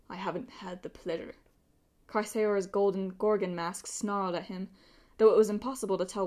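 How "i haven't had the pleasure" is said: put-on softer velvety villain with a light accent; reply softly in a lightly exotic accent; exotic Middle Eastern accent. A narrator's reading. Golden warrior answers Percy, soft exotic accent. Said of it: "voice was rich and velvety, with an exotic accent".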